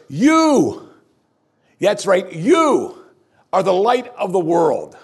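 "You" belted high and loud, then "light" stressed; emphatic proclamation. Acted. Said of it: "it's emphatic in the Greek".

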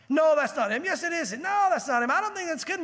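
bickering crowd. Retold or imitated. Neighbors arguing over the man.

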